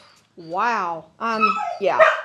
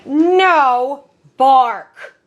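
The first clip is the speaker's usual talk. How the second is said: slow, louder, firm command; stern command tone; 4 semitones higher, 6 dB louder than usual. Shown as a demonstration. Trainer stopping her dog's barking, slow firm louder command. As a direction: drop a stern warning on the dog. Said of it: "That's my tone of voice for that".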